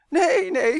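high, loud panicked cry; quick shout. A narrator's reading. Boy fending off mother's tickling fingers, shouting. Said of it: "schreeuwde Roy vlug".

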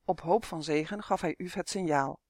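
usual speaking voice

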